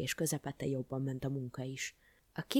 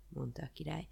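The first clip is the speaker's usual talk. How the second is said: plain reading voice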